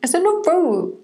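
high, hammed-up protest; mock indignant echo. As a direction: parrot a playful outburst up high. Echoes the friend's mock protest.

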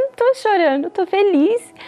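resposta animada em tom agudo; alegria relembrada. Retold or imitated. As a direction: reviva a alegria, subindo o tom. Responde feliz à vizinha. (resposta feliz, voz mais aguda)

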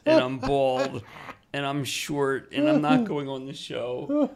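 a mock-desperate refusal, drawn out; loud, slow protest. Refusing the host's invitation, a loud slow protest.

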